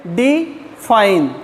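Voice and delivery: "Define" slowly in two separate pieces, डि, fine; split into syllables. Shown as a demonstration. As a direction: break "define" after डि with a clear pause. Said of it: "कितने टुकड़ों में बोल रहे हैं".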